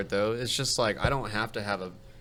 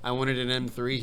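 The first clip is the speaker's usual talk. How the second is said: usual speaking voice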